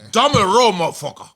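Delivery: a clumsy mock British accent; mock British accent, poorly done; bad British accent. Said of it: "maybe that wasn't the best British accent".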